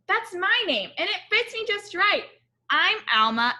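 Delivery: put-on a small girl declaring her name fits; proud child voice. Alma proudly tells her daddy her name fits, child voice.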